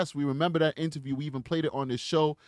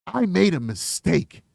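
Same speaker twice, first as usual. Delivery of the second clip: normal talking voice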